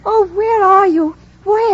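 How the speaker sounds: searching call to an unseen voice. Acted. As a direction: Jane cries out, hunting for the voice. Jane answers the distant call.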